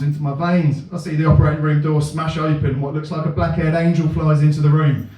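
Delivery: usual speaking voice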